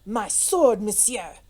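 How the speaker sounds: put-on mildly angry French-accented retort; curt challenge with rising temper; losing temper. A narrator's reading. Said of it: "was beginning to lose his temper".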